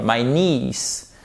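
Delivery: ordinary delivery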